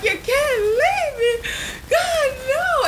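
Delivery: high, loud crying wail with a laugh; mock sobbing plea. Speaker retells begging her departing dad, crying wail. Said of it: "I cried so hard".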